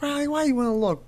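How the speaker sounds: high playful dad voice to his daughter; retold question to daughter. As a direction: ask the toddler in a high amused voice. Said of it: "I'm like".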